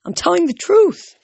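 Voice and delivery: very high, loud protest; indignant demand. A narrator's reading. Orphan girl defends her story to doubters, indignantly. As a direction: insist against disbelief, voice pitched right up. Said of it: "she demanded".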